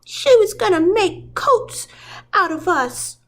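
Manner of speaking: a tiny pup telling the grown-ups; little puppy. Acted. A little puppy telling Pongo and Perdita, in a high small voice. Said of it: "one little puppy said".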